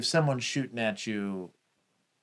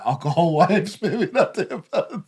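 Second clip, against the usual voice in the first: laughing; 8 semitones higher, 9 dB louder than usual. Real, not acted. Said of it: "You never know".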